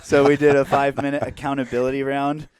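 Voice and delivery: ordinary talk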